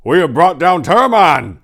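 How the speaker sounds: booming high-pitched proclamation to the tent; triumphant shout to his guests; commanding roar. Acted. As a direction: bellow it out like a warlord announcing victory. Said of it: "he said in a deep, commanding voice".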